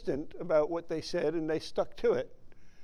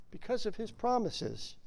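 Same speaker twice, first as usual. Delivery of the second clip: quiet repeat; 4 semitones higher, 8 dB quieter than usual. Shown as a demonstration.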